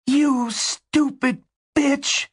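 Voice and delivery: very high, drawn-out rasping curse; furious rasp. A narrator's reading. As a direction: rasp it slowly in incredulous fury. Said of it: "she rasped".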